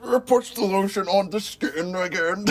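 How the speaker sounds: strained, as if constipated, laughing at the attempt; Ted Levine. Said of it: "my impression of Ted Levine".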